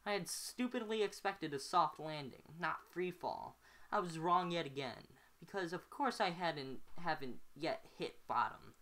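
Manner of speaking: ordinary delivery, nothing marked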